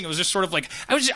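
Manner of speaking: normal talking voice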